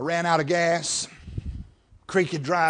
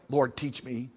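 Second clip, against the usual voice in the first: low, soft plea; earnest prayer; 5 semitones lower, 8 dB quieter than usual. Acted. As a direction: model a quiet prayer for the congregation. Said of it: "You know what you ought to pray?".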